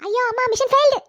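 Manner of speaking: high, loud yelp of defeat; comic alarm. Acted. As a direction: cry out as the scheme collapses. Wife cries out as plan fails. (பதறி அலறல்)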